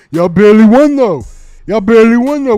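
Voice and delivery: taunting. Real, not acted. Streamer taunting Cowboys fans in chat, loud and teasing. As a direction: needle them with a grin.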